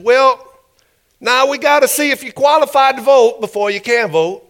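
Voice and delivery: high, loud put-on official's voice; challenging poll official. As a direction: pitch it up and challenge the would-be voter.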